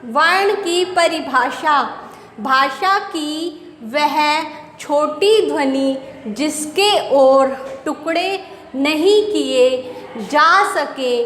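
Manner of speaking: very slow, deliberate dictation; slow dictation for students taking notes; slow and careful. Shown as a demonstration. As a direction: lay out each word so the class can copy it down. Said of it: "I repeat, please listen carefully".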